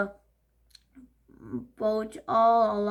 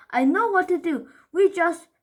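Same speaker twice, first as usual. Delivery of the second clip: high, loud, eager; bossy urgent; 7 semitones higher, 7 dB louder than usual. Acted. Paul eagerly taking charge of the panic. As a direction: take over the crisis.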